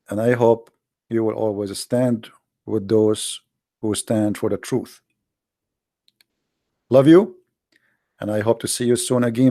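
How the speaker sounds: ordinary delivery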